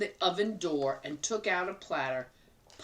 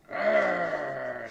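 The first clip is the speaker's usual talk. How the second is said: bear growl; 4 semitones lower, 3 dB louder than usual. Acted. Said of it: "said the bear".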